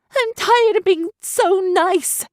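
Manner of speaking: high, slow furious shout. A narrator's reading. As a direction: shout it out, fed up. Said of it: "Evian shouted".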